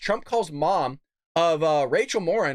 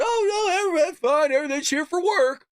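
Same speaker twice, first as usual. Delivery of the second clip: mocking; 9 semitones higher than usual. Host mocking officials who claim all is fine, high sing-song mockery. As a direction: play the smug gaslighter, way up high.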